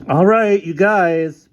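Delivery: high, loud interjection; exasperated protest. Acted. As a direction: break up a squabble. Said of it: "protested the desk sergeant".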